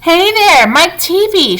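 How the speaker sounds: high, carrying call across the boat; shouted call. A narrator's reading. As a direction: call out to get the boy's attention. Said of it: "shouted Mister Wonka".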